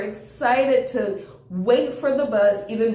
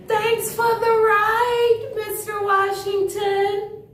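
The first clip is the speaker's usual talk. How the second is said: calling out; 11 semitones higher than usual. Acted. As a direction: sing it out to the departing driver. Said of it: "he called".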